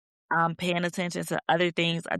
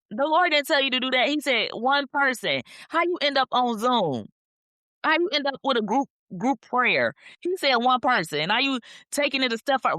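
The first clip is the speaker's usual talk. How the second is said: high-pitched, exasperated telling-off; mock scolding; 6 semitones higher than usual. Host chiding people who overdo God's instruction, exasperated.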